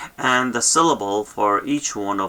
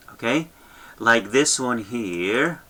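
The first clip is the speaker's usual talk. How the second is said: ordinary delivery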